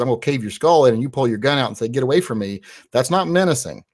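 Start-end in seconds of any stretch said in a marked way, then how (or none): none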